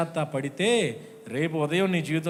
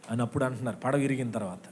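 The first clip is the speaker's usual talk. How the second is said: ordinary talk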